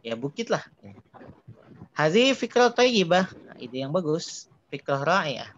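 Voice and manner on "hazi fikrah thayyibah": higher, louder and slow, like an excited friend; eager reading. Shown as a demonstration. Teacher reads a character agreeing to the plan, excited and slow. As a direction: read the line out with bright enthusiasm.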